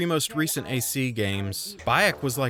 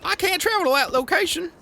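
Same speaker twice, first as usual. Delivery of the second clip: much higher and louder, a nervous small-town deputy; Barney Fife voice; 5 dB louder than usual. Retold or imitated. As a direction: play the horse as a jittery Barney Fife. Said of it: "sounds like Barney Fife".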